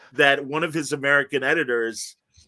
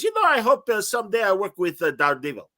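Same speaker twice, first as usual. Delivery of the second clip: put-on much higher, hopeful Italian-accented voice; mock Italian friend speaking; playful Italian accent impression; imitating Italian accent; 6 semitones higher than usual. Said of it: "he goes".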